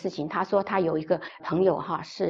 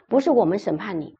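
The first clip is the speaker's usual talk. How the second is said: ordinary delivery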